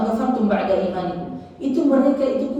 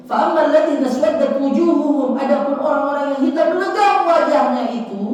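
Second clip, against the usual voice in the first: high, insistent sermon voice; forceful verse exposition; impassioned preaching; 7 semitones higher, 6 dB louder than usual. Acted. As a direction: preach verse and meaning with raised pitch. Preacher recites and translates.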